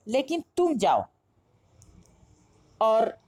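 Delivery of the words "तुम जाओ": तुम punched, जाओ left light and falling; weight on the first word, तुम; stress on तुम. Shown as a demonstration. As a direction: hit तुम as the one who must go. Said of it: "तुम पर बल देते हैं".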